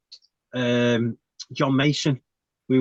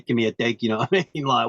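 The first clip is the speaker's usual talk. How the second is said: usual speaking voice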